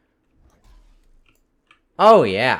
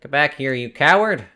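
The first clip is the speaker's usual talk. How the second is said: shouting; 3 semitones higher, 6 dB louder than usual. Real, not acted. Yelling at a fleeing enemy.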